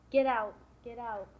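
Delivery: loud, emphatic order to leave; retold sharp order.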